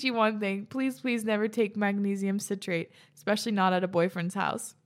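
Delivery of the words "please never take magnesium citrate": ordinary talk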